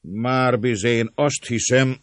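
high, slow, drawn-out answer; loud reply. A narrator's reading. Servant leader answering the offer, loud and deliberate. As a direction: weigh it out loudly.

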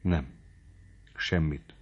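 usual speaking voice

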